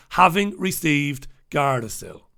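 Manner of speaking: ordinary delivery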